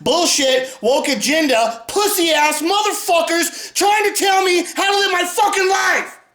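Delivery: high, raging yell; furious shout. Real, not acted. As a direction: let the fury explode. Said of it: "Do I sound angry?".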